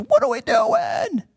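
high, frantic shout of worry; panicked cry. Retold or imitated. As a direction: yell it like your mind is racing. Anxious mind after quitting job.

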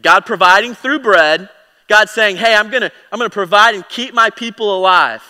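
impassioned sermon voice; fervent preaching. Acted. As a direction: drive it home with rising fervour. Preaching at full force.

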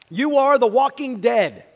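slow, loud walking dead; loud, slow punchline; emphatic declaration. Acted. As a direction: land it heavily. Preacher punching translation.

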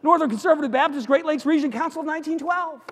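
high, loud, keyed-up delivery; breathless-sounding comic build-up; climactic questioning. Acted. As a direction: push the long title out excitedly. Climactic question of escalating joke.

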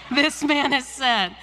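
joking over-the-top agreement; mock eagerness. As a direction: play along with exaggerated eagerness. Joking reply to the lady's question.

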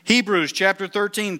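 ordinary talk

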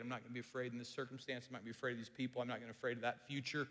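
soft, fast murmured resolve; hushed, quick prayer; quiet prayer. Pretend. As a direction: murmur the promise to God under your breath. Prayer voiced softly, fast.

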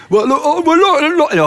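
high-pitched mock God voice; abandoned, hammed-up attempt at God's voice. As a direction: try out a silly high voice for God. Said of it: "I don't know what he sounds like".

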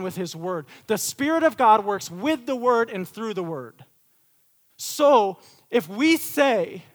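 loud, high, driving sermon delivery; rising fervent preacher's cry; full-force preaching. Acted. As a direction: preach it out hard to the room.

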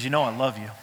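God gently reassuring David; tender God voice. Retold or imitated. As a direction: speak as a loving father reassuring. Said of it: "He says".

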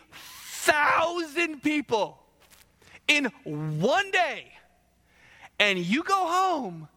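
high, drawn-out disbelief; incredulous. Real, not acted. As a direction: stretch each word in disbelief. Preacher incredulous at the king.

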